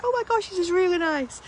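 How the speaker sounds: high, excited quote voice; excited friend quote. Retold or imitated. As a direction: quote him with big delight. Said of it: "he went".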